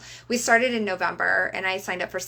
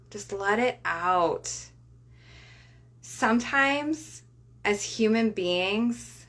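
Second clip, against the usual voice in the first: guided breathing. Acted. Leads a breathing exercise.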